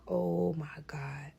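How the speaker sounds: slow, breathless whisper of shock. Retold or imitated. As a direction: hush it like a secret. Cop reacting in shock, hushed.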